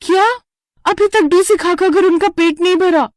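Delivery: can't believe they're still eating; outraged tired wife; exasperated disbelief. Acted. Bride exasperated at more work.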